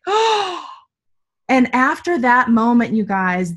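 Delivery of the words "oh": sharp gasp, then a high, sudden oh; the penny drops all at once; gasped realization. Retold or imitated. Retelling her moment of insight, a gasp and a high oh. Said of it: "And I was like".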